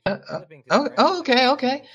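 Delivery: high, startled woman's voice; high surprised hello from a sweeping woman; flustered innkeeper woman. Acted.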